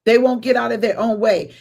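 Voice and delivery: ordinary talk, nothing marked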